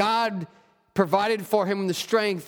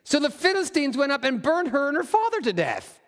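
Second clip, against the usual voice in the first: steady, measured Bible reading voice; scripture reading; 7 semitones higher than usual. Acted. Pastor reading Judges aloud to his church, measured scripture reading. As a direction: read the passage aloud to the congregation.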